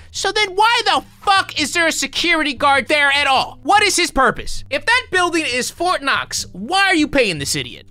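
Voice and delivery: high-pitched, incredulous rant; exasperated outburst. Real, not acted. Exasperated rant at the video's logic.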